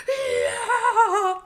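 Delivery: high loud laughing cheer; excited cheer. Retold or imitated. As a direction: whoop with glee. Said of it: "So I was really like".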